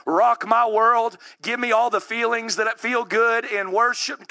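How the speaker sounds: ordinary talk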